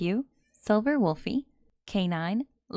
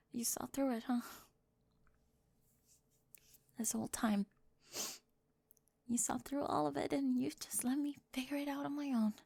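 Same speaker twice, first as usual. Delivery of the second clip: softer whisper, almost breathless with awe; whispered realization; 5 semitones higher, 10 dB quieter than usual. Acted. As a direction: let it slip out under your breath.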